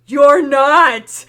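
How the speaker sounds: loud, outraged "you're not"; disbelieving shout. Real, not acted. GM reacts in disbelief.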